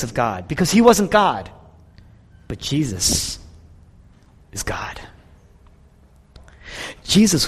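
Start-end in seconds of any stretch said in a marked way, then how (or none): 2.5-5.0 soft awed whisper. Acted. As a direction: let it fall to a hush. Climax of contrast, hushed.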